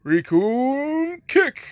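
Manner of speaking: high, slow, drawn-out battle cry; loud stretched-out special move call; announced attack shout. Acted. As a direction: bellow the move name like an anime fighter.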